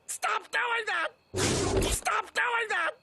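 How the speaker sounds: high, slow, drawn-out yell; exasperated shout. Acted. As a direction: yell at the copycat to quit it. Annoyed by echoing selves.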